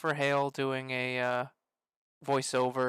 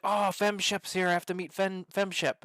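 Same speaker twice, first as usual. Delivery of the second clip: higher, slowed-down fangirl gush; retold excitement, pitched up; excited fan; 5 semitones higher than usual. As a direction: imitate a starstruck girlfriend. Quoting excited girlfriend at convention.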